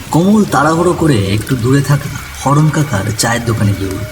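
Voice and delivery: ordinary delivery, nothing marked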